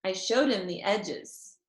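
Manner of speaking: plain reading voice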